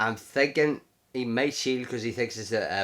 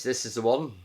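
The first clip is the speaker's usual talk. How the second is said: usual speaking voice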